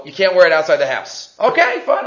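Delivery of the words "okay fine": higher-pitched, giving-in wife; lightly acted reluctant agreement; grudging wife.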